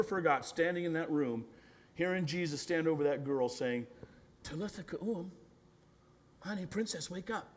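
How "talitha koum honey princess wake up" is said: hushed, tender waking call; gentle, intimate. Acted. Jesus standing over that girl, gentle endearment. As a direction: speak very softly to a sleeping little girl. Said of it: "that gentleness, that intimacy".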